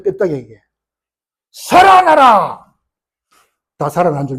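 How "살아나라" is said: very slow, high, shouted command. Acted. Ezekiel ordering the dry bones, in a slow shout. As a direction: call the dry bones to life.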